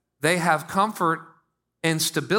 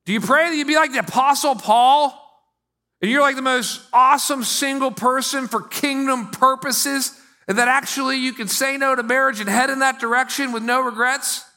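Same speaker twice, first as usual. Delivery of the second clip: animated questioning; 8 semitones higher, 4 dB louder than usual. Real, not acted. Preacher builds on singleness.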